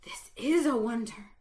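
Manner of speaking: whispered in awe; breathed. Acted. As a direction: breathe it out to yourself in wonder. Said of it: "I breathed to myself".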